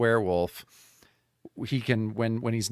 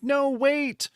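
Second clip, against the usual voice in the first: kind plea; 10 semitones higher than usual. Acted. The doctor calming the monsters, high and tender. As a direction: gently talk them down. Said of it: "she's very kind".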